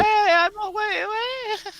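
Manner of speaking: high, slow sing-song taunt; high teasing voice. Acted. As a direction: tease in a high lilting voice. Character taunts, snatching the book.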